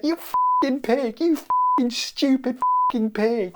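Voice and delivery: hammed-up outraged squeal; anguished cursing. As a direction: wail insults as the hurt caterpillar. Acted caterpillar curses the pig.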